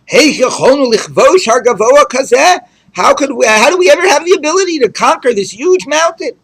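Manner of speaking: lightly acted tearful lament; crying righteous. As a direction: wail it like a mourner. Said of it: "cry and say".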